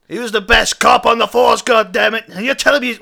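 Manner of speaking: acted outraged protest; indignant outburst. Detective defends dead friend angrily.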